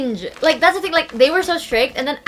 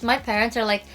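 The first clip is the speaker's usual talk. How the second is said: normal talking voice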